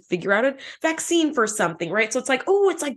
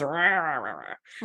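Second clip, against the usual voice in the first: rough low rah, fading out; croaky voice imitation; 6 semitones lower, 5 dB quieter, slower than usual. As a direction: croak it like a cracking sick voice. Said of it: "my voice is doing the thing it always does".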